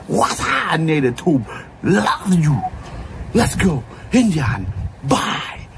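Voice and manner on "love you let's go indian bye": a breathy whisper of love; whispered sign-off. Real, not acted. Signing off to his Native Tube viewers, whispering.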